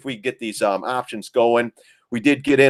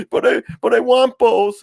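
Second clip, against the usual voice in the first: high-pitched imitation of an aggrieved girl; mock preteen objection, pitched way up; protesting daughter; 4 dB louder than usual. Daughter objects to twins going with Grandma, mock protest. Daughter insists on both plans.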